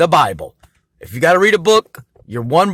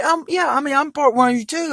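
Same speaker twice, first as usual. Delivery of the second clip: mock high voice; 11 semitones higher than usual. Telling Caucasians he is one of them, high mock voice. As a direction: jump way up high for the joke.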